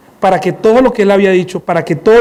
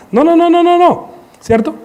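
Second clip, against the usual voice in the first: 1 shocked disapproval; 10 semitones higher than usual. Acted.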